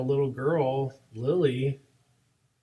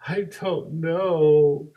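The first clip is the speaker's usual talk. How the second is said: drawn-out, louder mock wail; imitated crying child; 3 semitones higher, 7 dB louder, much slower than usual. Little girl answering her teacher, imitated crying. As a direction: imitate a little kid wailing. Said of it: "and she's crying".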